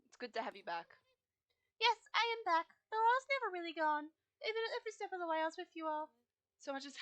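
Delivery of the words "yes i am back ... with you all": higher voice for the wise guide Fripp; lightly voiced cosmic guide; voicing Fripp. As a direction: speak as the ancient guide, proudly. Player voices Fripp character.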